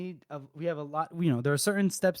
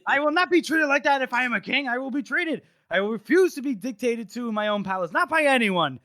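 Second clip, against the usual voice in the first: higher, louder royal indignation; mock indignant outburst; 6 semitones higher, 4 dB louder than usual. King refusing to be dictated to, indignant outburst. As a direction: insist like an offended young king. King angrily defending himself.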